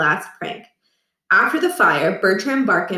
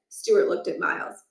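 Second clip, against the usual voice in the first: ordinary delivery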